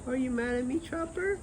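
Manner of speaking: very high sing-song pet talk. Real, not acted. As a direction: plead sweetly with Chopper, rising. Asking sulking dog if mad.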